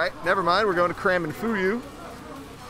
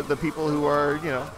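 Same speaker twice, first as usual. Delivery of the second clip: normal talking voice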